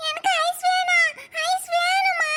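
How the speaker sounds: high, drawn-out pleading; tearful child whine. Acted. Child begging mother for ice cream, tearful whine. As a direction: whine like a child about to cry. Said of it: "ஏன்டா குட்டி அழுவுற?".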